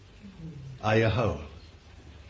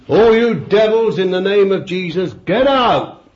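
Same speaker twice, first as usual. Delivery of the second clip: high, forceful shout at demons; loud, high-pitched rebuke; shouted command; 8 semitones higher, 10 dB louder than usual. Shown as a demonstration.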